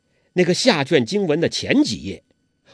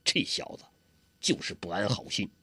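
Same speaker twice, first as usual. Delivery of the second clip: much softer, half-whispered grumble; muttered aside; 6 semitones lower, 12 dB quieter than usual. Acted. 洪七公低声对郭靖嘀咕. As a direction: grumble under breath.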